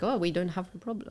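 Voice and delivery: mock Scandinavian accent; hammy Scandinavian accent impression; bad Scandinavian accent. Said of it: "Like, that's really bad accent".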